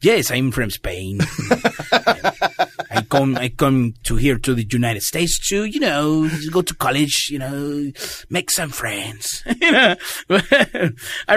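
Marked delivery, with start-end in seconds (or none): 0.0-1.2 drawn-out fake Spanish accent; mock Spaniard, slow Spanish accent; put-on Spanish accent. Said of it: "I just ran with it". 2.9-9.4 halting fake Spanish accent; mock foreign student, Spanish accent; put-on Spanish accent. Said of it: "I just ran with it".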